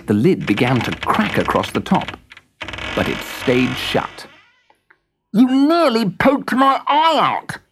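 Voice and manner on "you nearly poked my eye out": much higher, slow, louder grumble; grumbling Jack. Acted. Jack grumbling at the screwdriver, high and slow. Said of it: "grumbled Jack in the Box".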